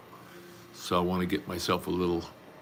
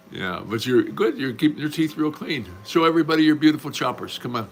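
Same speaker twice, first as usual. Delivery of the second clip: coaxing; 7 semitones higher, 5 dB louder than usual. Real, not acted. Coaxing someone to show teeth.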